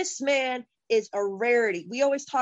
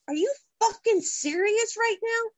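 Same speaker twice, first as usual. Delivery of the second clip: incredulous outburst; 9 semitones higher than usual. Real, not acted. Fan asking WWE in outraged disbelief. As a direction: pitch jumps high in disbelief.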